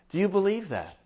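normal talking voice

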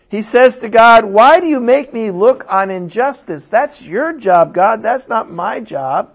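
fiery sermon crying out to God; preaching at full force. Acted. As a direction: thunder the why questions. Preaches Habakkuk's outcry.

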